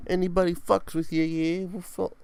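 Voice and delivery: high, loud put-on English gangster; mock English mobster; English accent. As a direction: play a cockney heavy, way up in pitch. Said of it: "I just got English for a second".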